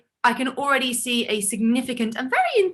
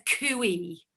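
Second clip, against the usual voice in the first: mum's cooey; 4 semitones lower, 7 dB quieter than usual. Retold or imitated. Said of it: "my mum says".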